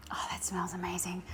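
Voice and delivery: soft awed whisper. Real, not acted. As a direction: breathe it out in a whisper. Smelling freshly cut bread.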